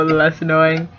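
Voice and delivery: ordinary delivery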